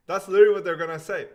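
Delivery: loud, fast, incredulous buyer; mock buyer outrage. Seller imitating buyers balking at the price, loud mock outrage. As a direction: blurt it out like an offended buyer.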